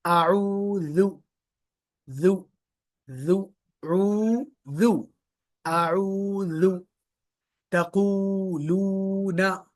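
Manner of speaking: आऊज़ु and तक़ूलूना slowly with the long ऊ held; stretched long vowels. Shown as a demonstration. Teacher stretching the long vowels for the class. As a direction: hold every long vowel in these words. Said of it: "stretch क्यों कर रहे हैं? क्योंकि long vowel है".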